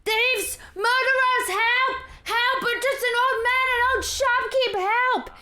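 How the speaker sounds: put-on high-pitched panicked old shopkeep's cries; screaming for help. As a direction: scream to rouse the whole street. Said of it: "he starts screaming".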